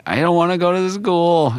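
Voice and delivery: high, whiny little boy voice, imitating his crying; mock a sobbing kid refusing school, pitched high; whiny crying child. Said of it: "crying and saying".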